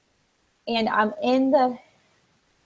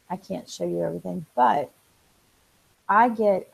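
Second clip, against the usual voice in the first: normal talking voice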